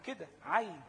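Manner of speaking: عين with the long ya held; letter name ʿayn. Shown as a demonstration. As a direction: say عين with the ya stretched. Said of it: "هو اسمه في اللغة العربية كده". (نطق عين بمد الياء)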